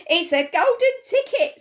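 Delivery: high, loud ecstatic scream. A narrator's reading. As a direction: shriek it with disbelief. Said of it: "screamed the shopkeeper".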